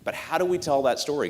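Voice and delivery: ordinary talk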